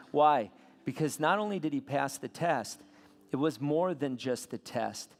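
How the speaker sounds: usual speaking voice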